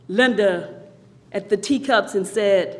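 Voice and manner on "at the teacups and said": ordinary delivery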